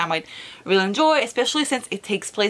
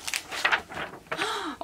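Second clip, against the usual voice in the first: sharp breathless gasp of delight; soft awed intake of breath; 12 semitones higher, 7 dB quieter than usual. Real, not acted. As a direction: gasp at something beautiful. Seeing the pretty book.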